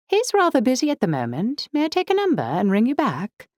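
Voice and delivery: high, swooping upper-class British woman; upper-class British accent. A narrator's reading. Woman taking a message from a caller, upper-class British accent. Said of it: "Upper-class British accent".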